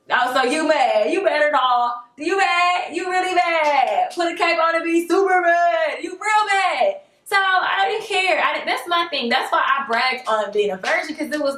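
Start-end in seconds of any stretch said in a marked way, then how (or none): 0.1-7.0 teasing, sing-song taunt, rising; mocking taunt. To the sulking ex-date: mocking, teasing taunt. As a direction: needle him, slow and gleeful. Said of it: "I was like".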